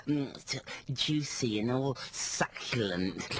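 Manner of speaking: slow, savouring mock advert voice; imitating advert woman. Mocking the M&S advert woman, slow and savouring. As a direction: drag it out like you're savouring food. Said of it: "She does that".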